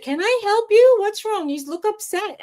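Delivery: high, kindly helper voice; concerned stranger. Retold or imitated. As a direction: imitate a worried shopper offering help. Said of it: "someone said".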